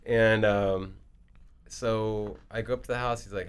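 usual speaking voice

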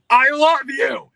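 high, loud, slow shout of love; shouted declaration out the window; mock shout. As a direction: yell it at a stranger at a red light. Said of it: "look 'em in the eye".

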